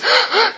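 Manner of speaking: a sudden high gasp of shock, half laughing; sharp high gasp. Retold or imitated. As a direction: gasp in shock as the gun comes up. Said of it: "and goes".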